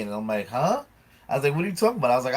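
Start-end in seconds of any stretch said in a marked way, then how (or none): 0.5-0.8 pitched-up questioning huh; baffled huh. Retold or imitated. Man retelling being called vain, baffled. 1.5-2.1 higher, incredulous question; baffled retort. Retold or imitated. Retelling his reply to a friend's accusation, baffled.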